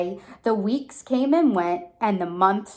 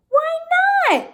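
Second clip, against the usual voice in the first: high, loud cheering cry; 6 dB louder than usual. A narrator's reading. The crowd cheering Kevin to come down, high and loud. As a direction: cheer him on. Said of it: "cried the Ruse with a super loud cheer".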